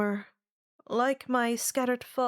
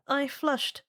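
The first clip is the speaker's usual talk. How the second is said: ordinary delivery, nothing marked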